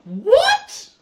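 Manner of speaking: high, loud shocked yelp; a stunned high-pitched What. Real, not acted. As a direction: blurt it out in disbelief. Shocked by the clip.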